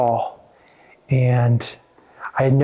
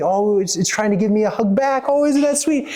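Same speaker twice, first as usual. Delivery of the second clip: put-on high, delighted child's voice rising higher at sweet; a child thrilled by the puppy's hug; delighted little girl; 5 semitones higher than usual. Little girl thinking her puppy hugs back, delighted and high.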